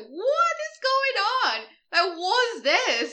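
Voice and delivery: loud, very high mock bewilderment; incredulous outburst. Film fan reacting to talk of an exorcism, incredulous outburst. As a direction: wail it out, utterly baffled.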